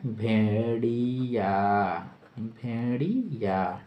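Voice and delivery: Bhediya drawn out syllable by syllable; slow dictation. Shown as a demonstration. Teacher dictating the meaning of wolf, drawn out slowly. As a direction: stretch Bhediya slowly, both times.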